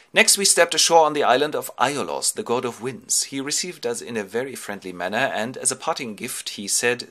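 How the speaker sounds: ordinary delivery, nothing marked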